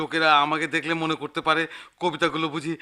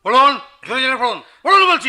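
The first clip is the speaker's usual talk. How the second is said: loud, higher, fast command; shouted order; 6 semitones higher, 9 dB louder, faster than usual. Acted. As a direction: bark the order at him. Manager orders editor to read. (চেঁচিয়ে আদেশ)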